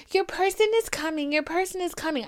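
high-pitched mimicry of reassuring friends; empty platitudes. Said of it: "tell me empty platitudes".

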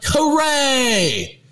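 high cheering hooray; kid hooray. Retold or imitated. Brother and I cheering about going to camp, high excited hooray. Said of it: "We're like".

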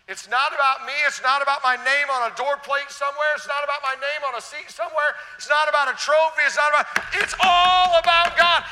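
loud, high preaching that builds in force; rising preaching. Acted. Preacher to congregation, building to a loud high cry.

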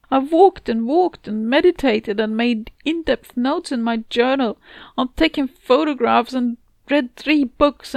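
upset, pleading protest, lifted above the narration; frustrated narrator protesting. Acted. Telling the cat she can't relax, frustrated and helpless. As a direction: protest helplessly, listing every failed effort.

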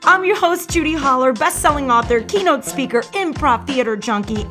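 ordinary talk

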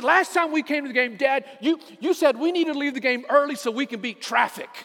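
angry daughter. Retold or imitated. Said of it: "she got angry".

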